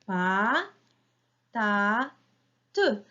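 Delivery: "Pa, ta, te" as three separate beats, first two drawn out and rising; split into syllables. Shown as a demonstration. Teacher counts with children, syllable by syllable. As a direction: chop "Pa, ta, te" apart with a pause after each. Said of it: "trois syllabes".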